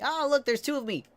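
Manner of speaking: loud delighted squeal; thrilled to see a double; excited. Real, not acted. Sees a second Boothill.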